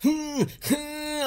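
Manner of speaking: high-pitched, drawn-out "hmm, hmm"; two long high hums; coy high humming. Retold or imitated. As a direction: hum evasively way up high. Acts out dodging a spoiler.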